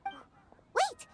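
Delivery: sudden surprise. Acted. Paimon interrupts the guild clerk as it clicks, surprised. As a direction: stop mid-thought, struck by an idea.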